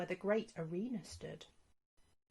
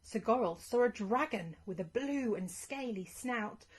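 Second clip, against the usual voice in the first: ordinary delivery, nothing marked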